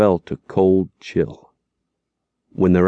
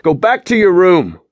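a raised, stern warning order; 11 semitones higher, 6 dB louder than usual. Acted. Father orders son back to his room, stern warning. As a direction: send him off with a warning. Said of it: "voice was filled with warning".

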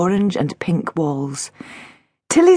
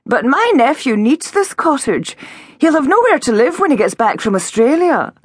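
sugary, over-sweet apology, pitched higher; syrup sponge voice; 8 semitones higher, 4 dB louder than usual. Acted. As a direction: pour on the fake sweetness. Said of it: "her syrup sponge voice".

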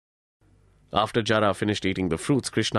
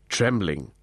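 ordinary delivery

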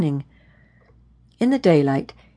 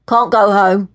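put-on raised urban young man's voice; calling after; 3 semitones higher, 6 dB louder than usual. A narrator's reading. Young man to the woman walking away, calling after her. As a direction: call it after someone walking off. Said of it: "he called after her".

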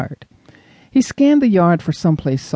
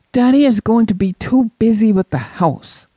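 shooting back; 7 semitones higher than usual. Acted. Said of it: "Jesse shot back".

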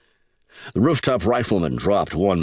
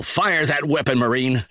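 urgent screamed order; 7 semitones higher than usual. A narrator's reading. Major Jackson to the corporal as attackers pour out, urgent screamed order. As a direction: call out a combat order, voice pitched well up. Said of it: "Major Jackson screamed at the corporal".

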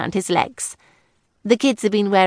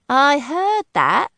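slow, higher, louder call; wife calling after her husband, mock indignant; called after him; 7 semitones higher, 4 dB louder, much slower than usual. A narrator's reading. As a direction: drag it out after his back. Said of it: "Daisy had called after her husband".

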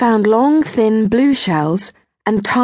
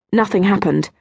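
ordinary delivery

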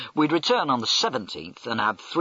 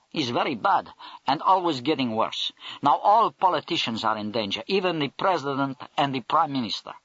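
put-on Russian accent; 3 semitones higher than usual. Russian major warns about politicians.

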